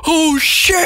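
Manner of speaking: high, stretched-out mock shock; hammed-up collective freak-out; shocked outcry. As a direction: draw it out high like a stunned crowd. Everybody's shocked reaction, acted out.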